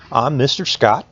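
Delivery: plain reading voice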